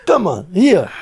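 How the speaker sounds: a touch of taunting, slightly loud; hammed-up cruel guard; mocking guard. Inmate retelling the guard's taunt, lightly mocking.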